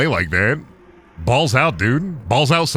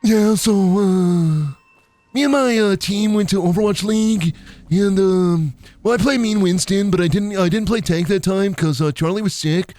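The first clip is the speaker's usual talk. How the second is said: put-on higher-pitched awkward kid voice; stretched, halting monologue full of fillers; 5 semitones higher than usual. Kid describing Overwatch to a captive girl, droning kid voice. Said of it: "Can you do your best That Kid voice".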